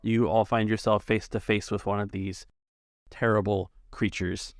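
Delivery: normal talking voice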